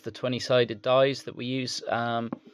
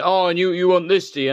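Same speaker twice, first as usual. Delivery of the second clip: mocking challenge, higher and louder, in a put-on voice; imitating an angry rival; 7 semitones higher, 8 dB louder, faster than usual. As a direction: play the jealous guy needling the collector. Rude rival at car boot.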